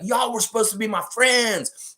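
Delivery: high, strained cry of accusation; hammed-up high outrage; betrayed Velociraptor. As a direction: cry out at the friends who turned. Supercharged Raptor rebukes wolves.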